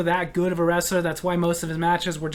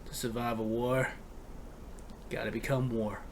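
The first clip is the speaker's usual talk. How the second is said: much lower and softer, menacing; ominous doom voice; 10 semitones lower, 14 dB quieter than usual. Acted. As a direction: growl it out like a grim warlord. Commenter's ominous reply.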